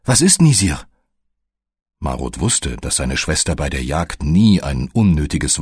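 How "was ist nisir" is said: mild erschrockene Frage, deutlich höher; startled question, pitched up but held in check; erschrocken. A narrator's reading. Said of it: "Marut erschrak". (leicht erschrocken fragen)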